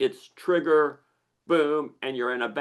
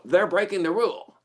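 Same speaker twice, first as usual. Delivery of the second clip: higher-pitched outburst of mild outrage; indignant accusation; 7 semitones higher, 4 dB louder than usual. Acted. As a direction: call out a rule-breaker, indignantly. Acting out indignant reaction to chewing.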